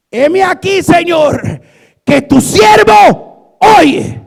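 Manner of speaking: high, loud, drawn-out cry; shouted answer. Acted. Boy Samuel answers God's call, high shouted reply. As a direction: cry it out at full voice, stretching the end.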